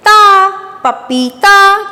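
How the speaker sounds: slow, stretched blending of syllables; sounding out words. Shown as a demonstration. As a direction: draw out each syllable, then join them. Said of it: "अब इसको पढ़कर देखते हैं".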